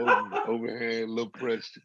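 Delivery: words squeezed out through laughter; laughing while talking. Real, not acted. Joking with the hosts, laughing through the words.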